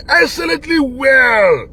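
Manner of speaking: "excellently well" said slowly with heavy stress; emphatic praise. Real, not acted. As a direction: lean hard on "excellently", drawn out.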